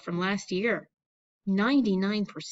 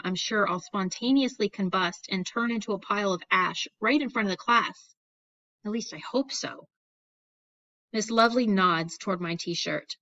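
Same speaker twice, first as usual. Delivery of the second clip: usual speaking voice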